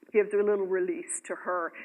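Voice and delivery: usual speaking voice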